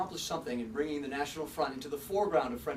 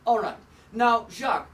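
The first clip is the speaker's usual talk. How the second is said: loud; 6 semitones higher, 8 dB louder than usual. Acted. Anchor regains control of interview.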